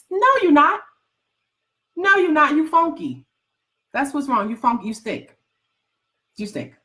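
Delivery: lightly voiced sassy put-down, high then fading; mock teasing. Coach teasing viewers who looked at goals once, sassy. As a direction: tease them like a big sister.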